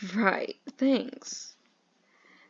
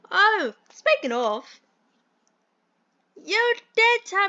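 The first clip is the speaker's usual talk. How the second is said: high-pitched stagey British gent; bad British accent; 7 semitones higher than usual. Mentor asks about the film's progress, hammed-up British accent. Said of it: "do the worst British accent".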